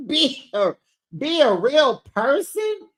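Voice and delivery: high, put-on voice poking fun; mocking repeat. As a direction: parrot the line back, mocking her.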